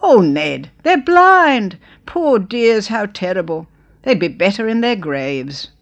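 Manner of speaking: loud, high pitying cry; kindly exclaiming. Acted. Old lady to husband Ned, loud, high pity. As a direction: sob it out to Ned in loud dismay. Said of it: "she exclaimed".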